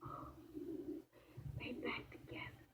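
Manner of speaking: hushed whisper of delight; whispered aside. Retold or imitated. A viewer whispering to the audience about the reunited pair, hushed. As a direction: breathe it out as a secret. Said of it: "I said".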